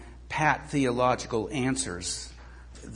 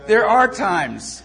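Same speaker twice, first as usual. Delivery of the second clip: laughing; 8 semitones higher, 10 dB louder, much slower than usual. Real, not acted. Still laughing after story.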